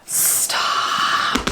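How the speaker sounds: soft, drawn-out whisper; whispered awe. Real, not acted. Vlogger overcome by another special edition, whispering. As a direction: whisper it in disbelief, stretched out.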